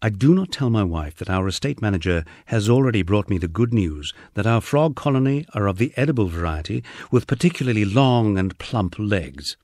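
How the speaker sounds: plain reading voice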